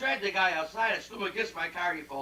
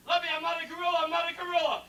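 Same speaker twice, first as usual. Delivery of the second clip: hysterical panic; 7 semitones higher, faster than usual. Acted. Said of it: "He got very hysterical".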